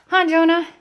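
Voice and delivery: normal talking voice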